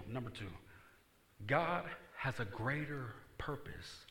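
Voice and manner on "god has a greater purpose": slow emphatic. Shown as a demonstration. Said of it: "repeated fast".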